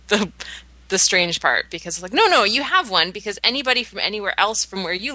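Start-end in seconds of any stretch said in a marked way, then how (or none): 2.1-3.1 insistent. Retold or imitated. Mock quote of accent denier.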